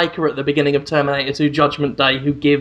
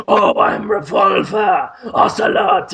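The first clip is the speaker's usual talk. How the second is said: slow, hammed-up shouted cat voice; imitating Gun Cat; slower than usual. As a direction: boast it out like a smug cartoon cat. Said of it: "And he's like".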